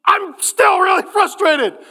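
high exasperated outburst; acted cry of frustration; mock frustrated shout. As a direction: complain to God at a high pitch, hammed up. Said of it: "And he says".

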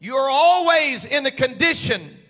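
loud, rising sermon preaching. Acted. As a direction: preach it with building fire. Preaching builds to full force.

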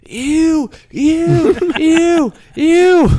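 high, loud, rising disgusted squeals; disgusted ape shrieks. Retold or imitated. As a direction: shriek like a grossed-out monkey. Apes at zoo yelling.

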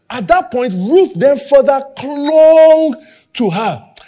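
ordinary talk

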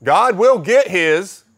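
mock guilt-trip pastor, slowing and loud on the threat; pushy pastor. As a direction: ham up a manipulative preacher. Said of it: "the pastor said".

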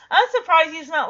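ordinary delivery

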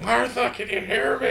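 high, strained call into empty rooms; mock Lynch shouting. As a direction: call out to someone who isn't there, hammed up. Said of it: "He's like".

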